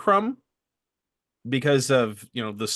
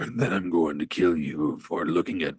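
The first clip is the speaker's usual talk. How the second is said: menacing low voice; sudden menacing; 10 semitones lower than usual. Retold or imitated. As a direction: drop low and threaten. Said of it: "goes from zero to a hundred".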